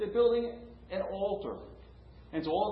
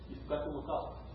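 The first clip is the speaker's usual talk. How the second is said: put-on softer, hushed child's reminder; son's soft reminder; 9 dB quieter than usual. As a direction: say it gently as the little boy. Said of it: "He says".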